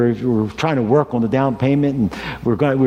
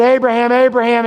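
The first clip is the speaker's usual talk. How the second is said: angel calling out; 9 semitones higher, 5 dB louder, faster than usual. Acted. Angel calls from heaven.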